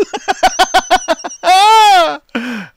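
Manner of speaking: high sped-up evil cackle; diabolical chipmunk laugh. Pretend. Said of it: "diabolical chipmunk".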